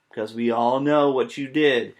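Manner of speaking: ordinary delivery, nothing marked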